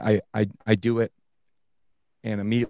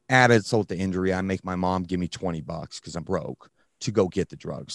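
normal talking voice

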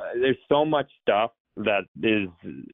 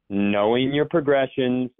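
normal talking voice

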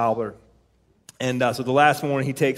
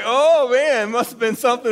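excited; 9 semitones higher, 3 dB louder than usual. Retold or imitated. Retelling own excited reaction.

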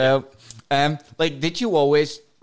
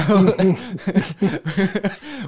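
laughing; 6 semitones higher than usual. Real, not acted. Laughs at radio voice joke.

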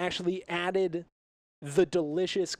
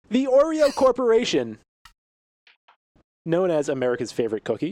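grand proclamation; 2 semitones higher, 8 dB louder than usual. Acted. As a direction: announce it proudly. Grand announcement.

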